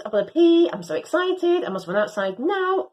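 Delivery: ordinary delivery, nothing marked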